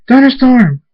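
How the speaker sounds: high, very loud yell; screamed name. A narrator's reading. Friend screams to Thunderstorm as tornadoes form, loud yell. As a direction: scream the name to get through. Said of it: "Murdash screams".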